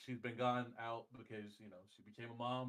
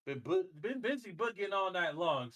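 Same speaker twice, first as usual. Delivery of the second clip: excited; 7 semitones higher, 8 dB louder than usual. Acted. Hyping the Boogie Woogie Man.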